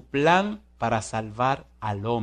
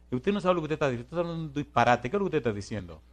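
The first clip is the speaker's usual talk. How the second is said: normal talking voice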